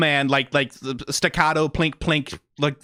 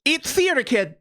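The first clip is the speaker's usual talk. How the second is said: exasperated disbelief; 9 semitones higher than usual. Real, not acted. Exasperated at the characters' realization.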